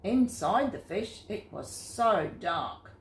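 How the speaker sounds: ordinary delivery, nothing marked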